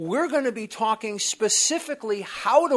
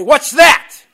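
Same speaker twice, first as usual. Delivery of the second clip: loud, higher snap of a question; retold loud challenge; 6 semitones higher, 16 dB louder than usual. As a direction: throw it back at him. Sponsor challenging his answer.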